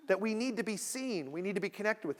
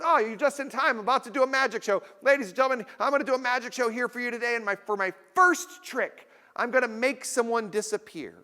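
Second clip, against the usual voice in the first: showman magician; 5 semitones higher than usual. Retold or imitated. Magician welcoming the passerby, bright showman voice. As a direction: announce it like a street magician. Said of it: "He goes".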